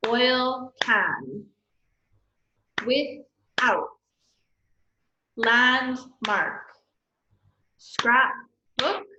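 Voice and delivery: each word split in two, as in RAINDROP: RAIN, pause, DROP; slow beats with a gap inside SNOWBALL and every other word; two separate syllables. Shown as a demonstration. Teacher modelling syllables for students, each word like LANDMARK broken in two. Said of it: "Each one should have two".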